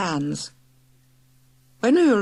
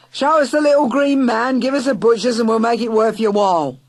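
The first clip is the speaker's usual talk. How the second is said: loud, high wheedling call; yelping reporter; 5 semitones higher, 7 dB louder than usual. Acted. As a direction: bark the offer through the door. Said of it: "he yelped".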